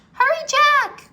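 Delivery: high, loud urgent call; girl urges her brother to flee; urgent cry. A narrator's reading. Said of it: "cried Annie".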